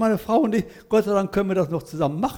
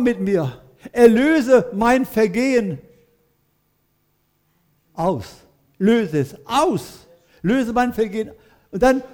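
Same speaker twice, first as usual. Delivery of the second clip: prayer reading; 3 semitones higher, slower than usual. Acted. Reads Psalm 51 as prayer.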